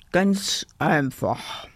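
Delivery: slow, mocking echo; cheeky call. A narrator's reading. Kasper mimics the bossy teddy, slow cheeky echo. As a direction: parrot it teasingly, drawn out. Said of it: "rief Kasper".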